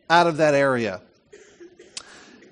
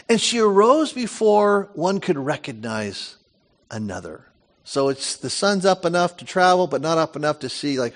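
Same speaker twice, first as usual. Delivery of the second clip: ordinary talk, nothing marked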